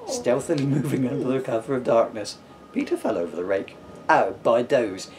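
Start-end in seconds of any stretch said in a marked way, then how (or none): 4.1-5.1 "by doze" said slowly as if the nose is hit; slow, stuffy mutter of "by doze"; blocked-nose mutter. Acted. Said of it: "he muttered".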